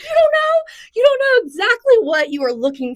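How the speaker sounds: high, loud excited blurt; squawking principal. Retold or imitated. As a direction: burst out like a chicken. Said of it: "comes gawking out like a chicken".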